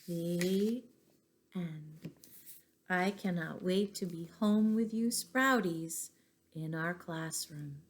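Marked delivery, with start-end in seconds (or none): none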